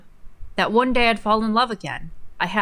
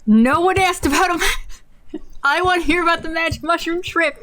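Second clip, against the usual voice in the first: mocking; 9 semitones higher, 4 dB louder than usual. Real, not acted. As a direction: scoff at the essay from up high. Mocking the essay.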